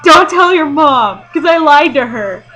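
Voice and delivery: put-on asking her boyfriend to keep a secret, sheepish; sheepish confession. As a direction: confess with a guilty laugh. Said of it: "I was just like".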